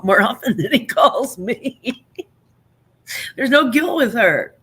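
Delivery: laughing through the words, pitch up; real laughter breaking into the words. Real, not acted. Mother laughing about her son preferring his aunt, laughing.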